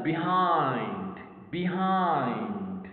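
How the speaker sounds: "behind" drawn out slowly, falling at the end; syllable demonstration. Shown as a demonstration. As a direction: model "behind" with a long second syllable. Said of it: "two syllables".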